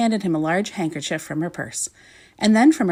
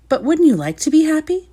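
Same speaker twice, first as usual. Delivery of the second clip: bright coaxing; 8 semitones higher, 5 dB louder than usual. Acted. Little Miss Sunshine coaxing the king.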